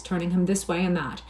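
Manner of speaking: ordinary delivery, nothing marked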